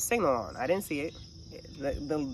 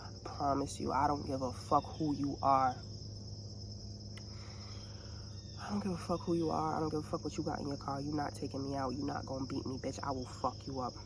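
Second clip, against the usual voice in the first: low threat; 3 semitones lower, 10 dB quieter than usual. Retold or imitated. Threatening driver under breath.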